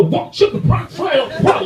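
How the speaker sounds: high, bouncy, overexcited American voice; lively American. Retold or imitated. Mocking lively Americans with a high, bouncy voice. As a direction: mimic an animated American. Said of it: "Active mimic speech".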